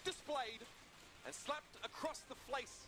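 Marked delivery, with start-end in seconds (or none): 1.3-2.9 slip: "flace" with an extra l, fl-ace; flace for face. Real, not acted. Caster fumbling "flace" mid-sentence. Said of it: "I said flace instead of face".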